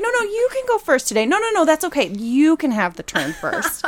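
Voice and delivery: mock generosity. Sibling dodging the first computer turn, mock generous. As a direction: imitate a kid over-eagerly giving up a turn.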